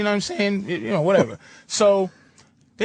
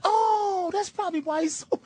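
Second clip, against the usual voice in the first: high, softer wife voice; imitated wife; 9 semitones higher, 4 dB quieter than usual. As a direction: mimic her dawning sympathy, pitched way up. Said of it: "She goes".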